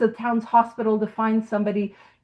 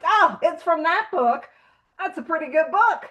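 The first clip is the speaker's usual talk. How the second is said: higher, mildly mock-pleased discovery; pleased realization; 5 semitones higher than usual. Bill talks himself into taking pay, lightly mock-pleased. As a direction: lightly act out a happy lightbulb moment.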